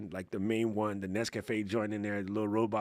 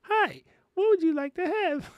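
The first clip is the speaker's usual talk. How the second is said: chirpy, much higher robotic greeting; high robot greeter voice; 5 dB louder than usual. Retold or imitated. The café robot greeting the customer, high and robotic. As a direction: squeak it out like a cheery little service robot. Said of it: "that comes and be like".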